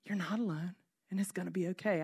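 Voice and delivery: soft, low reassurance; hushed soothing tone; still small voice. Acted. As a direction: comfort gently and quietly. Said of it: "small voice to say".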